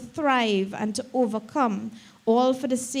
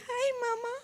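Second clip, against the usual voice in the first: tiny sweet voice, much higher and softer; a daughter imitating her mother's tiny sweet greeting; sweetest little voice; 13 semitones higher, 4 dB quieter, faster than usual. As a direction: coo it like a little girl greeting back. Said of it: "she would respond in the sweetest little voice".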